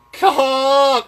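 high, loud goose "Caw"; spit moan. Shown as a demonstration. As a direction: drop the tongue and honk it. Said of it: "a spit moan is dropping that, going".

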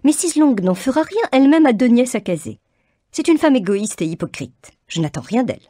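mother rails against Mrs Long, high and indignant. A narrator's reading. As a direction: scorn for a rival mother. Mrs Bennet voice.

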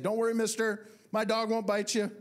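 high, loud call from a distance. Retold or imitated. Neighbor calling out to the bitten runner, high and loud. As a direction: shout reassurance down the street.

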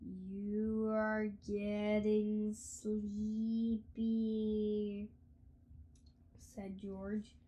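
slow, drawn-out hypnotist intoning. Acted. George putting Mister Krupp under, slow hypnotist drone. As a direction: stretch every word like a stage hypnotist. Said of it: "George hypnotizing Krupp".

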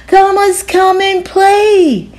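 high, slow call across the playground; calling from afar. Acted. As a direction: call out from far away. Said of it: "someone yelled".

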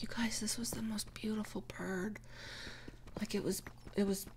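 breathless whispered awe; hushed, wide-eyed whisper; whispering girl. Retold or imitated. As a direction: whisper it like a precious secret. Retelling girl's hushed awe.

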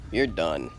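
ordinary delivery

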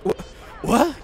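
high shocked what; startled squeak. Acted. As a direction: squeak it in disbelief. Startled Stephen.

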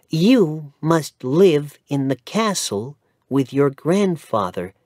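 mother telling her son about their new homes; lightly voiced mother. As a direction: explain gently to your child.

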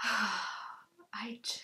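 whispered, overcome, after a sigh; sigh, then barely get a word out; choked-up whisper. Real, not acted. Overcome with emotion.

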